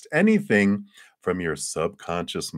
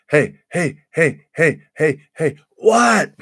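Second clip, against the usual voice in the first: put-on much higher, louder childish calling of hey; little kid nagging; 10 semitones higher, 8 dB louder, slower than usual. Fears acting like a kid demanding attention, high nagging child voice. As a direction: pester like an impatient little kid. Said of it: "It's like a little kid".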